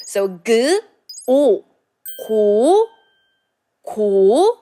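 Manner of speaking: teacher sounding out 고; Korean syllable demo. Shown as a demonstration. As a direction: build 고 from 그 and 오. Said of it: "two syllables has 그 sound".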